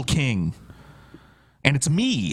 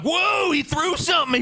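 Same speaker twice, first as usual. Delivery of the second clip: mock startled shout. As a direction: act out a startled gasp, hammed up.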